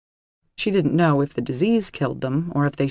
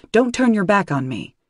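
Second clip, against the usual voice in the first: sharp scolding; 6 semitones higher, 4 dB louder than usual. Acted. Dream Danny scolds Kelly.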